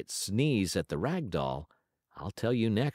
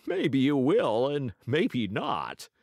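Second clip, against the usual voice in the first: laughing; 6 semitones higher, 4 dB louder, much slower than usual. Acted. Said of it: "laughed Uncle Wiggily".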